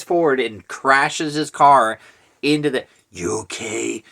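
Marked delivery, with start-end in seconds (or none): 3.1-4.0 mock superhero voice; Batman. Movie hero checking on someone, jokey Batman impression. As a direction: put on a spoof Batman voice. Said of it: "making him sound like Batman".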